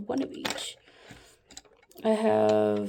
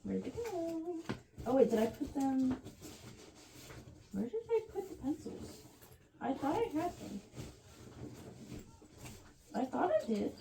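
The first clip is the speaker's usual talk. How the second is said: panicked; 4 semitones higher, 10 dB quieter than usual. Real, not acted. Searching for missing pencils, panicking.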